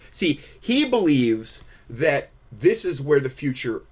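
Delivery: ordinary talk, nothing marked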